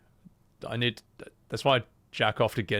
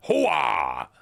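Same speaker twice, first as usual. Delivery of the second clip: Al Pacino hooah. Pretend. Greeting with Pacino-style hooah.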